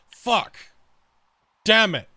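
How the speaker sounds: high, loud dog voice swearing in dismay; dog cursing. Retold or imitated. Owner voicing the dog denied treats, high loud cursing. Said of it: "he's like".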